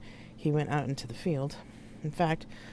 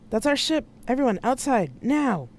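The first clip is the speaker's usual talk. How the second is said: high, loud call to everyone; raised voice, pitched way up; urgent shout; 8 semitones higher, 5 dB louder than usual. Acted. Urgent order as ship arrives.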